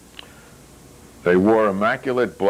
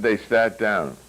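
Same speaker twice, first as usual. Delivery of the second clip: plain reading voice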